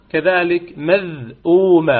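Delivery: مذءومة said very slowly, short madd; slow recitation. Shown as a demonstration. Teacher models the word for students. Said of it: "لا يجوز فيه إلا القصر".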